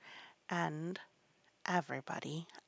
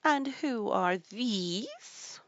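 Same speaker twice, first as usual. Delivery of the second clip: very slow, higher, drawn-out demand; imperious queen; 5 semitones higher, much slower than usual. A narrator's reading. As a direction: draw out a haughty royal question.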